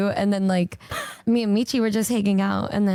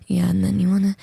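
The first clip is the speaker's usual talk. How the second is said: that voice; 3 semitones lower, faster than usual. Retold or imitated. Retelling how they talk on and on, that voice. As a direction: put on their low, insistent talking voice. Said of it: "have that voice like that".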